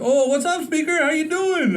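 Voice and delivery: chummy greeting to a friend you think is calling; overly casual greeting. Retold or imitated. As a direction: greet him like an old pal. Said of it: "I thought it was a fireman".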